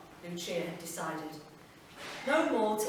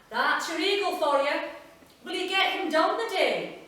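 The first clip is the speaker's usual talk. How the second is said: Willie, teasing; 8 semitones higher, 8 dB louder than usual. Acted. Willie teases Lucia about eagle.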